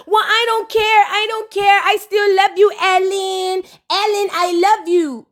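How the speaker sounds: a hint of a desperate girlfriend; mock lovesick plea. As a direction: beg him like a clingy lover. Mocking Flo's lovesick plea.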